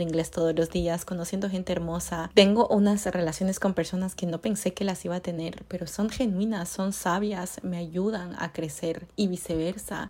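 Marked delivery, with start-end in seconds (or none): none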